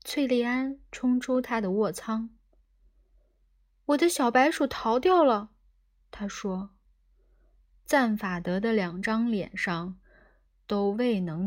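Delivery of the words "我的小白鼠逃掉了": higher, louder cry of alarm; agitated outcry. A narrator's reading. Trillian rushes out of her cabin about her escaped mouse, agitated. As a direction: burst out with the bad news.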